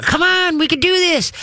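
very high, upbeat come on to the dog; high encouraging call. Shown as a demonstration. Trainer walking home calling the dog after them, very high and upbeat. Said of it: "start going towards home".

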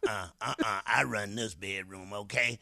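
low, firm bedroom boss voice; a hint of a dominating lady; lightly voiced bossy woman. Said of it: "she sound like that".

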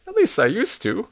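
put-on high-pitched old-man afterthought; excited. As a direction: lift it up with lingering excitement. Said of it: "says Nesbit excitedly".

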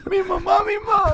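high squeaky alien whimper; crying alien, very high. Pretend. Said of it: "that's your alien voice".

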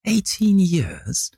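very high, slow incredulous echo; mock incredulity. A narrator's reading. Dorian mocking the gunman's mistake, slow and high. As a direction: stretch it out, savouring the triumph. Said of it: "touch of triumph in his voice".

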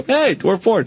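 high silly hey to himself; chirpy high mirror greeting; high mock greeting. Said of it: "look in the mirror and go".

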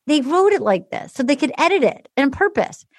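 ordinary talk

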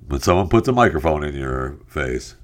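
usual speaking voice

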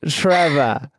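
mimicked drunken British accent; drunk British friend. Imitating a British streamer drunk on stream, slurry British accent. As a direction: call out in a mock British accent. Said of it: "make fun of him sometimes when he does that".

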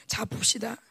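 ordinary delivery

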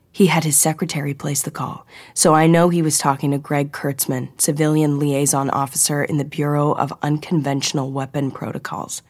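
plain reading voice

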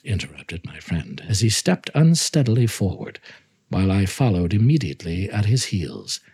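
ordinary delivery

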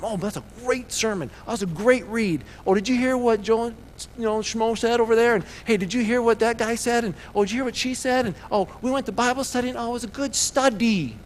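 high-pitched, gushing churchgoer; mock gushing chatter. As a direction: gush and gossip on a high, unbroken pitch. Mimicking people who only talk sermons.

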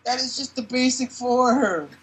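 high smooth salesman doctor. Acted. Voicing the doctor character.

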